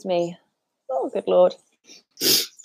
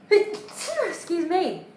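high, drawn-out sneeze into an apology; sneeze, then say it on the tail of it; sneezing; 9 semitones higher, 3 dB quieter, much slower than usual. Real, not acted. Presenter amid a very big sneezing fit, sneezing. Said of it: "Just had a very big sneezing fit".

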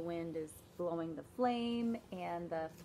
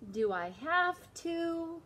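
high, slow reluctant whine; whiny kid; 7 semitones higher, slower than usual. Acted. David whining to mom, high and slow.

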